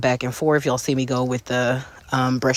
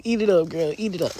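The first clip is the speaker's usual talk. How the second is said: sassy diva; 4 semitones higher than usual. Pretend. Diva hype to viewers.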